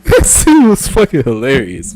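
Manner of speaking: high, loud, slow and tickled; real leftover laughter in the voice; amused, coming out of laughter. Real, not acted. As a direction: say it loudly on the tail of a laugh. Still laughing at the scene.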